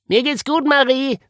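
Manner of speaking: high, loud little animal voice; squeaky, upbeat cartoon character; perky bunny character. A narrator's reading. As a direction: chirp it out to reassure. Woggle reassures Marie brightly.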